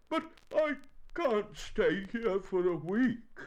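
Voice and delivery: high, loud, slow protest; dismayed bear. Acted. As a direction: wail it as the stuck bear.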